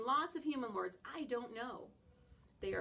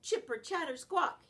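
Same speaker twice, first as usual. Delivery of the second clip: high, loud chattering squeal; squirrel screech; 6 semitones higher, 7 dB louder than usual. Acted. Squirrel on the fence screeching at the dog, high and loud. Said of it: "he screeches".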